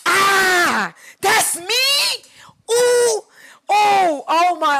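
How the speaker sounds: loud, high, almost crying with joy; overwhelmed joyful cry at her own photo; teary screaming. Real, not acted. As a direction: shriek through happy tears. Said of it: "I'm about to cry all over again".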